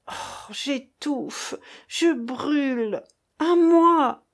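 choked, drawn-out gasps rising to a loud, high cry; stammering in agony. A narrator's reading. Dying Raphaël writhes and calls for help, stammering in agony. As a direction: drag the words out, then cry for help. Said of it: "balbutia le malheureux en se tordant".

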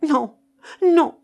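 high, loud, alarmed protest; loud refusal. Acted. Keinec refuses, thinking his companion mad, loud refusal. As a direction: refuse at a shout.

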